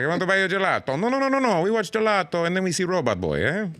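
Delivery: excited sleazy Italian man insisting; Italian accent. Retold or imitated. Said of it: "And he was like".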